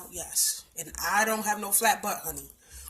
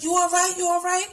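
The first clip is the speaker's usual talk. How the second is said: worried bystanders; 10 semitones higher, 4 dB louder than usual. Retold or imitated. Bystanders asking her, worried.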